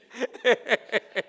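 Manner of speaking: a hearty loud laugh. Real, not acted. Preacher laughing at the airport joke, loud. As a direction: burst out laughing.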